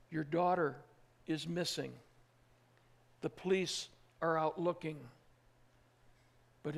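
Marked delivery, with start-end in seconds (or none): none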